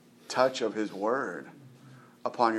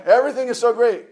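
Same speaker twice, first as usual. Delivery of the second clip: "Everything is so great" much higher, louder and fast, hammed-up; 10 semitones higher, 7 dB louder, faster than usual. Preacher mocking Christians' fake perfection, rushed hammed-up gush. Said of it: "put on this air of".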